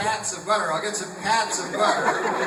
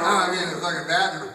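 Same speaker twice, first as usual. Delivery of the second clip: ordinary talk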